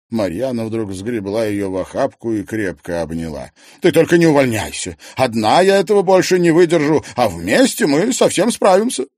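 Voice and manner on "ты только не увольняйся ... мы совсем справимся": high, loud, emphatic appeal; pleading plump colleague. Acted. Maryana hugs Liza and begs her to stay, pleading.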